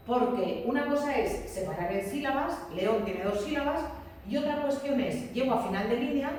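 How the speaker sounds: usual speaking voice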